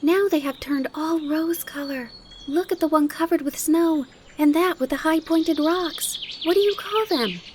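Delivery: put-on high, loud child marvelling aloud; high-pitched wonder, eager to know; fresh excitement. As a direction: gush like an excited little girl. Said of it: "cried Heidi in fresh excitement".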